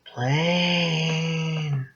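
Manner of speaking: high, soft, eerie ghost voice; ghoulish voice. A narrator's reading. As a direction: call out from the dark like a ghoul. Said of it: "The ghoulish sound".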